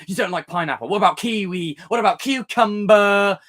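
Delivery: whiny nitpicker voice. Retold or imitated. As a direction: pile on the silly questions, getting shriller.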